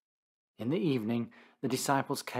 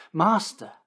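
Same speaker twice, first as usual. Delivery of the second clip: higher, louder eager call; eager exclaim; 7 semitones higher, 6 dB louder than usual. Acted. Peter interposes on the mountain to Jesus, eager higher exclaim. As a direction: burst in with eager wonder. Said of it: "exclaimed Peter".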